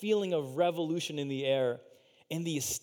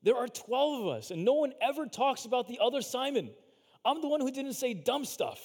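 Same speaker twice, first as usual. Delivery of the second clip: higher, a touch of self-pity; lightly voiced sulking disciple, pitched up; lonely disciple; 7 semitones higher than usual. The other Simon complaining he is overlooked, mildly sulky. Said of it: "There's probably loneliness".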